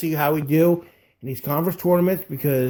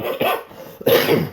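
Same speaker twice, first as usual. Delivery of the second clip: words squeezed out before a cough; cough over word. Real, not acted. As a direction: voice catching on a tickle in the throat. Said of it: "you got a cough over there?".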